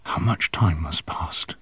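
soft, slow, hushed question; whispering. Acted. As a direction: barely breathe the words out. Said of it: "he whispered".

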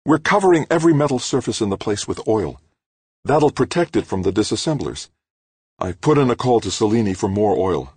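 confident woman reassuring over the radio; bright, strong. A narrator's reading. Cardenas telling injured Grant the plan, bright and strong. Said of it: "Cardenas's voice sounded bright, strong".

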